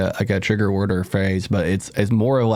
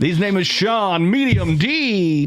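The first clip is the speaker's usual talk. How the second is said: announcer voice; 9 semitones higher, much slower than usual. Pretend. Announcer intro.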